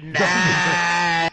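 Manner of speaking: loud scoffing nah; mock dismissive shout. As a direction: shout it off with contempt. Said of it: "You're like".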